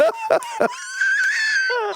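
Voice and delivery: shrieking high burst of laughter; loud laughter. Real, not acted. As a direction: howl with laughter at the hang-up. Cracking up at his story.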